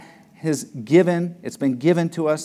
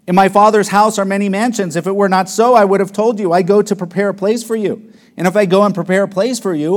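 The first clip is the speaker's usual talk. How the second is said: loud, ringing scripture reading; preaching scripture at full force; 4 semitones higher, 5 dB louder, faster than usual. Acted. Preacher booming Jesus' promise to the disciples, full force. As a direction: proclaim it to the congregation.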